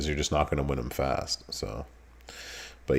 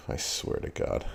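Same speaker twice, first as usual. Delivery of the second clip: hushed oath; 8 dB quieter than usual. Real, not acted. Jokingly swearing off a game.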